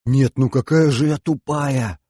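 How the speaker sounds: dismayed exclamation at oneself; upset. Acted. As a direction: lament your own slowness. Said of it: "огорчённо вздохнула Тимоша".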